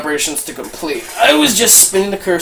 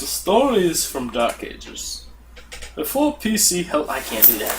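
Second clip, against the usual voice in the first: cool fireside storyteller; 8 dB quieter than usual. Pretend. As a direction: narrate softly, playing it cool. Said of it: "look as cool as possible for this".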